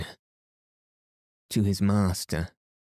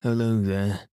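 mildly raised calling voice; an understated storybook shout; shouted greeting. A narrator's reading. Cyril hailing rescuers from the church tower, mild shout. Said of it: "shouted Cyril from the church tower".

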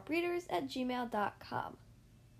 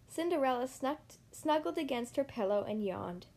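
ordinary delivery

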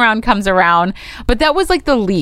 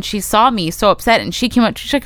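ordinary talk, nothing marked

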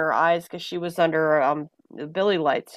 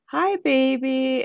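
slow, higher, gentle hello to a newborn; tender greeting; 6 semitones higher, slower than usual. Retold or imitated. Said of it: "and she goes".

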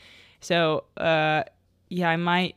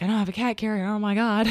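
high panicked cry; 5 semitones higher, faster than usual. Retold or imitated. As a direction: pitch up in a panic. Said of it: "it was very stressful".

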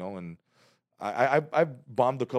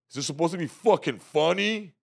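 heckler yell; 8 semitones higher, 5 dB louder than usual. Retold or imitated. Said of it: "And it's loud".

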